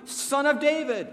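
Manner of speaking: a desperate beggar calling across a crowd; crying out. Retold or imitated. Blind beggar keeps crying to Jesus, loud and high. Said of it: "he kept crying out".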